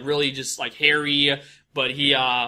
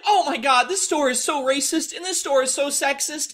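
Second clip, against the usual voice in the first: very high, whiny indignation; hammed-up offended squeal; mock outraged customer; 11 semitones higher than usual. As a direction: protest in a put-on high voice. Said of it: "And then they're all like".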